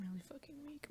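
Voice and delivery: weak, very soft near-whisper. Real, not acted. Telling viewers of weakness, in a whisper. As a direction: say it hushed and faint. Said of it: "we're just gonna whisper in this bitch".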